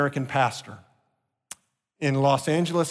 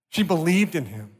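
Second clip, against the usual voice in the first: ordinary delivery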